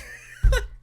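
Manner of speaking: high breathy cackle; burst of laughter. Real, not acted. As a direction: break into a wild laugh. Cackling about bullying claim.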